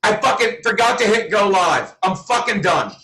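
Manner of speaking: usual speaking voice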